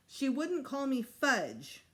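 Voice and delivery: lightly voiced sulky small child; whiny little boy. Fudge complaining to the principal, slow and sulky.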